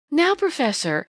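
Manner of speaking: coquettish. A narrator's reading. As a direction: tease him with a coy lilt. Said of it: "in a coquettish tone".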